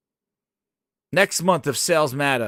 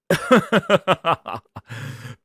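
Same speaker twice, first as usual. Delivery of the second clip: bursts out laughing; real laugh at his own gag; amused laugh. Real, not acted.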